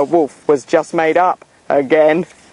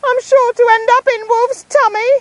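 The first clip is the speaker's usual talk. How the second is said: desperate call; 4 dB louder than usual. Acted. Boy pinned by wolf calls desperately to town, high and loud. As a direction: scream for Mummy in panic. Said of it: "Nick called desperately".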